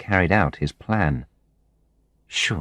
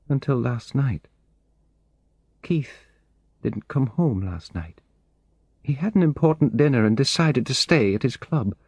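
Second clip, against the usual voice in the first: somewhat higher voice, steadier now after the tears; a woman confiding a worry she can't shake; lightly voiced anxious woman telling her story; calmer, confiding woman; 7 semitones higher than usual. A narrator's reading.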